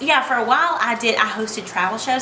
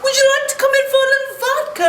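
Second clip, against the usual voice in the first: mock Russian host; 13 semitones higher, 4 dB louder, faster than usual. Voices Russian man offering vodka.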